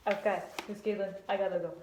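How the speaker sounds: bow out as a badly faked Italian; lightly voiced Italian puppet; bad Italian accent. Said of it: "I gotta work on my Italian accent".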